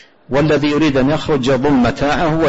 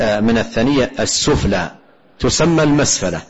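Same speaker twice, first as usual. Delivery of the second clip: ordinary talk, nothing marked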